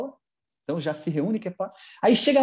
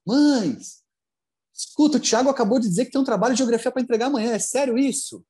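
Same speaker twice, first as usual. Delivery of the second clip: alarmed parent; 5 semitones higher, 4 dB louder than usual. Retold or imitated. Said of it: "ele vai lá no grupo de WhatsApp".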